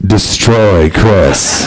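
low, very slow menacing growl; deep, drawn-out villainous command; evil inner voice. Retold or imitated. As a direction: drag the words out like evil taking over. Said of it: "I had evil in me".

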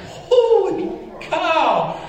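high, slow, loud and laughing; can't believe how far it went; laughing amazement. Retold or imitated. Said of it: "I was like".

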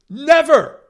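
never, yelled high and loud; a forceful exclamation; shouted never. Real, not acted. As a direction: hammer the point home with a big shout. Insisting on Fury's uniqueness.

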